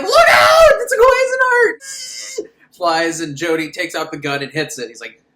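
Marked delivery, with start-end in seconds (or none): 0.0-2.5 very high, loud, slow yell; hammed-up frantic cry; panicked mock shout. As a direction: scream a warning in mock panic. Panicked warning at flying sphere.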